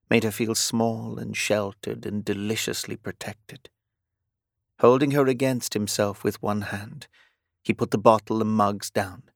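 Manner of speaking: plain reading voice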